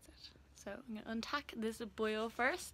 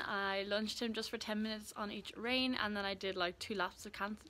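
normal talking voice

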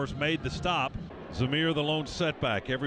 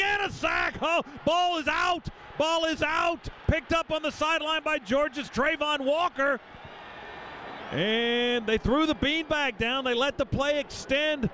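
excited shout; 9 semitones higher than usual. Real, not acted. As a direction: yell the big play live. Sack and fumble, live call.

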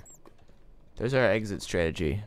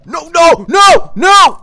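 high, loud desperate yells; panicked shouting; 17 dB louder, much slower than usual. Real, not acted. Gamer shouting as the skeleton kills him, panicked. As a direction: scream it as everything slips away.

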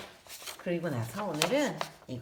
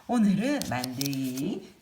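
ordinary talk, nothing marked

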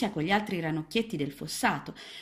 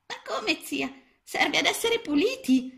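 put-on very high, small child-frog voice; a tiny lost frog, unsure; little frog; 12 semitones higher, 5 dB louder than usual. A narrator's reading. Lost little frog answering Mrs Balzelli, high and small.

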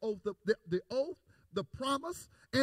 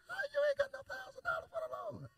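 high, soft mocking question, much higher; mock incredulous; 13 semitones higher, 6 dB quieter, faster than usual. Preacher mocking the stingy churchgoer, high incredulous squeak. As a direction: squeak out the disbelief at the excuse.

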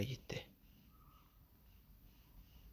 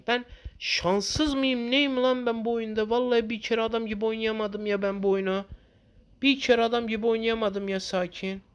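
higher, exasperated complaining; frustrated rant; 7 semitones higher than usual. Real, not acted. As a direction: vent your fed-up anger at the game. Ranting about dying in game. (oyuna sinirlenmiş, yüksek sesle yakınarak)

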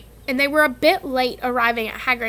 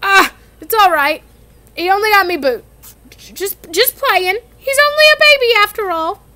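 higher, louder fond giant voice; doting Hagrid; 8 semitones higher, 8 dB louder than usual. Acted. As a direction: brush off a dragon bite lovingly. Hagrid excusing the bitten leg.

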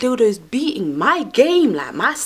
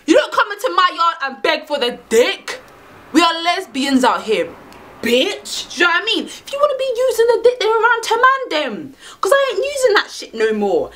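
sassy telling-off; 7 semitones higher than usual. Pretend. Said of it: "So that's what I would have said".

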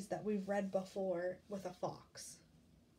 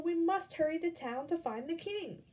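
high, loud rallying call; alarmed chick crying out; urgent cry; 7 semitones higher, 6 dB louder than usual. Acted. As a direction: urge everyone on. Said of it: "cried Chicken Little".

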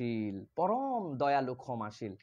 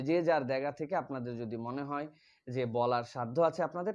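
usual speaking voice

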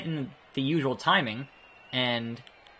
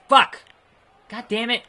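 much higher, louder curse; angry shout; 9 semitones higher, 7 dB louder than usual. Real, not acted. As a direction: yell in anger. Angry at failed move.

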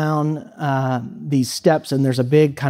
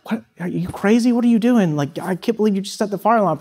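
imitating the roommate's stunned outrage, pitched higher; higher, rushed mock alarm at a friend; shocked disbelief; 6 semitones higher than usual. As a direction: retell a startled new roommate who can't believe it. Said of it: "looked at me like".

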